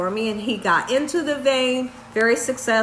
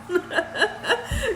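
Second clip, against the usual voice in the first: high giggle fit; high-pitched rippling laughter; real giggling; 9 semitones higher, 4 dB quieter than usual. Real, not acted. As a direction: giggle helplessly at your own story. Laughs at nurse's remark.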